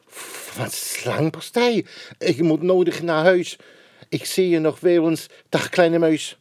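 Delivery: high, hurried scared goodbye; frightened snake. Acted. Snake says goodbye to the mouse, high and scared. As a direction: snake panics and backs off.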